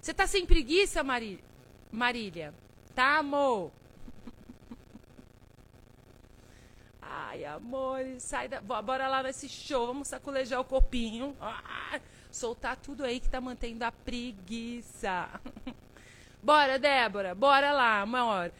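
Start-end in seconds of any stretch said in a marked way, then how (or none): 0.1-9.2 bem mais alto e agudo, brincando com a seguidora; chamado animado. Real, not acted. 16.4-18.5 bem mais alto, puxando a plateia; incentivo animado. Real, not acted. Animando seguidora na live.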